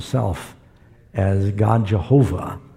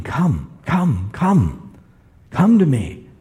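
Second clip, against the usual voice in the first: urgent invitation; 3 semitones higher, much slower than usual. Retold or imitated. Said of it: "urgency there in the voice of God".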